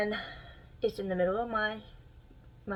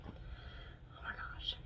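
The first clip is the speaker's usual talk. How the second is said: whisper; 13 dB quieter, much slower than usual. Real, not acted.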